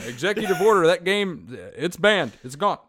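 loud, high blustering decree; mock president. Podcaster bans a game by executive order, loud presidential bluster. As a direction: proclaim it at full volume like a ruler.

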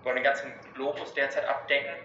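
usual speaking voice

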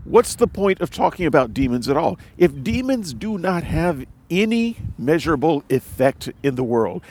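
ordinary delivery

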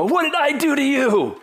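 high-pitched mock protest to heaven; imitating exasperated father. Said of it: "look up at heaven and go".

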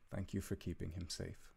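a hint of a proud, tender father saying goodbye; gently voiced father, understated; lightly voiced loving father. Father says farewell to his son Calum, lightly voiced and tender. Kaharic thanks the owl.

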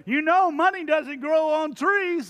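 loud, high fatherly scolding; dad voice. Retold or imitated. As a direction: lecture the kids like your dad did. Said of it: "I'll say to them something like this".

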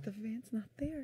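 whispered reveal. Real, not acted. Repeating the shock to the cohost in a whisper. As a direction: breathe it as a secret.

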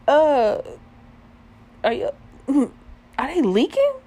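high, loud, halting disbelief with a laugh; stunned question. Retold or imitated. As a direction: act out stammering shock.

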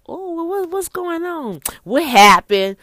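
high, startled what's going on; suspicious surprise. Acted. Woman surprised her man asks her out, high suspicious voice. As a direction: she can't believe he's asking.

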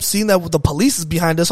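ordinary talk

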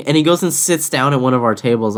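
ordinary talk, nothing marked

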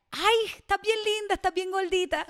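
high, sweet, slow doting granny voice with a laugh; doting grandma. Retold or imitated. Retelling her grandma's loving comment, high doting granny voice. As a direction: coo it like a grandmother fussing over you. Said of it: "Mi abuelita me decía".

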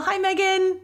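high mom-calling singout. Real, not acted. Podcast host greets her co-host in a mom-calling-you voice. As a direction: call her name like a mom. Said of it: "like mom's calling your name".

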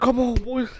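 high excited mock dad; imitating the buzzing father. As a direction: hype it up like the eager dad. Dad voiced in retold story.

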